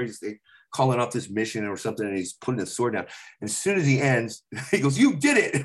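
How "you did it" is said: director cheering. Retold or imitated.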